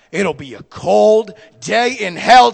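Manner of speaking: slow, loud, each word planted; defiant retort. Retold or imitated. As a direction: dig in and refuse.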